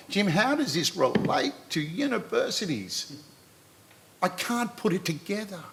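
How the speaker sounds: high, soft mock-questioning voice; puzzled objector. Speaker mimics an audience member doubting the relevance, high soft puzzled voice.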